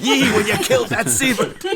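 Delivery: hammed-up old sea dog, laughing through; old sailor voice cracking into a laugh. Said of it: "spoke like a, like an old sailor".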